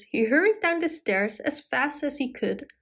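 plain reading voice

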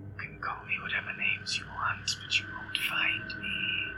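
mocking whisper from nowhere; creature whisper. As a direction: tease him in a hushed voice. Monster mocks sheriff.

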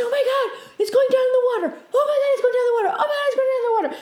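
very high, squealed retold panic; freaking out. Dreamer seeing a plane head for water, panicked squeal. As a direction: re-enact a frantic repeated cry. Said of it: "I'm freaking out".

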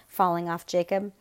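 ordinary delivery, nothing marked

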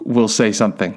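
ordinary delivery, nothing marked

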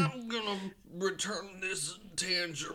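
put-on high, slowing old man; put-on elderly voice. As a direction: grumble as a comic old-timer. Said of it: "Your old man voice".

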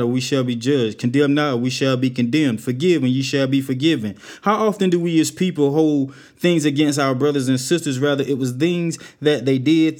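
loud, ringing preacher's delivery; full-force sermon delivery; preaching. Acted. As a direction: preach it out to the congregation.

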